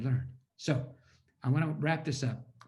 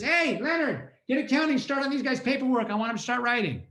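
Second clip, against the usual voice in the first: executive ordering; 9 semitones higher, 5 dB louder than usual. Retold or imitated. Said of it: "So she says".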